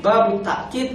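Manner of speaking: "babut takkid" with a catch after ta; reading with glottal stop. Shown as a demonstration. Teacher quoting scholars' second variant, "babut takkid" with a stop. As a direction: say "babut takkid", stopping briefly in ta'-kid. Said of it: "ada yang mengatakan babut takkid".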